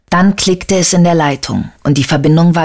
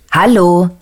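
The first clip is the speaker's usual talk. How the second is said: mild mock yell; 2 semitones higher than usual. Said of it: "brüllte Murat".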